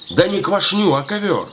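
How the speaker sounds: high, insistent correction; shouted correction. A narrator's reading. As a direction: correct a hard-of-hearing wife, pitch jumping up. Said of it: "старик кричит". (настойчиво поправляет, высоким голосом)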